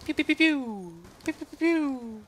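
high mock laser gun pews; high playful pew pew gunfire; gunshot noises. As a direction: make toy gun sounds up high. Imitating gunfire.

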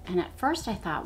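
ordinary delivery

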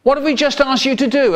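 angry parent. Retold or imitated. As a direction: snap at the child in exasperation. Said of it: "They say".

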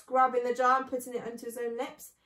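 usual speaking voice

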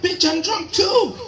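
high, slow, outraged comeback; indignant retort. Retold or imitated. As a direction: fire back high and slow, half laughing. Retorting to wife.

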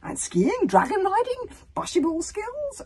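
very high, loud, rising questions; eager questioning. A narrator's reading. Stoic interviewing the hero about skills, eager. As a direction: list each skill with mounting eagerness.

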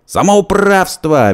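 very high loud slow shriek. A narrator's reading. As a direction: shriek the outrage. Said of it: "визжал он". (визг, очень высоко)

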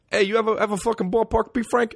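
put-on very high, brash New York accent. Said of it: "the most New York thing".

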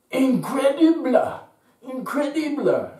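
very high, loud, breathless cries of amazement; overjoyed woman shouting in disbelief; excited exclaiming. Retold or imitated. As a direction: shriek it like you can't believe your eyes. Italian woman runs out exclaiming.

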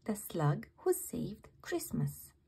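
usual speaking voice

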